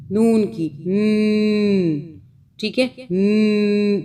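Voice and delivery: "न" stretched very slowly, as a teaching sound; drawn-out sound. Shown as a demonstration. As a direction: hold the "न" sound long and slow. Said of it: "वो यूं निकलता है". ("न" को बहुत खींचकर)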